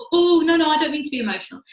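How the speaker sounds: mock-pleading, brushing off the criticism; flustered protest. Young self denying being emotional to family, flustered. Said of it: "I'd be like".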